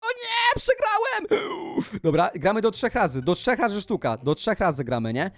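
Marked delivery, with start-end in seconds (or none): 0.0-1.5 high hammed-up wail; mock despair. YouTuber losing to the stalker, high mock-despairing wail. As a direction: wail it like a sore loser. Loses the round.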